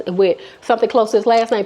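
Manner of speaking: ordinary delivery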